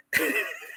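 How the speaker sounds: airy high-pitched laugh; a quick soft wheeze of laughter; short unvoiced giggle at the joke; high breathy laugh. Real, not acted. Laughing at fight trash talk.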